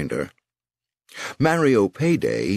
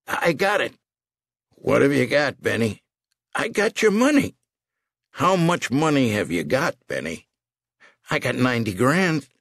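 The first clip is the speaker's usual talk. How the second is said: put-on high, strained old man; old bookmaker upside down; 7 semitones higher than usual. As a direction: gasp out the offer.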